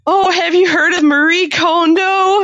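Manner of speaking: high, slow, excited mom; mom's voice. Retold or imitated. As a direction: imitate an eager mom.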